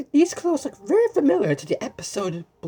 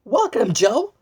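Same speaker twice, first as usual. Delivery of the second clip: mock shout; 5 semitones higher, 5 dB louder, slower than usual. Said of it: "everyone shouting".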